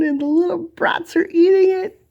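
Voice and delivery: fed up, scold the brats; exasperated. Real, not acted. Farmer exasperated at animals eating her garden, fed-up scolding.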